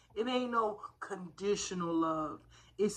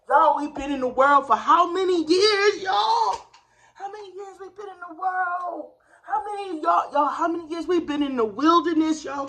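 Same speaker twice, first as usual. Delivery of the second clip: much louder, much higher, full preacher call; preaching shout; 8 semitones higher, 11 dB louder than usual. Real, not acted. Rousing viewers like a church crowd, shouting. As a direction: call out to the congregation.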